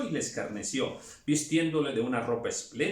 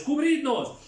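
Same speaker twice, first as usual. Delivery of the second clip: higher, slow crying out; desperate plea; 11 semitones higher, 6 dB louder, slower than usual. Acted. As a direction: beg the mountains. Desperate cry to hills. (súplica desesperada)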